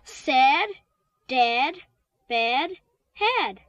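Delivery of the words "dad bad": ordinary delivery, nothing marked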